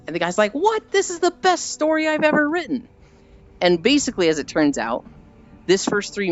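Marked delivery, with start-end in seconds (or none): 0.5-2.9 very high-pitched, incredulous protest; hammed-up squeal of disbelief; mock outraged writer. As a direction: imitate an indignant author, voice shooting way up. Writer protests rejection in retold scene.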